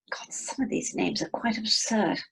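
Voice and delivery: snooty whispered aside; posh wife whispering her disdain. Acted. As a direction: whisper it behind your hand.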